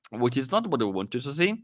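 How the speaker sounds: ordinary talk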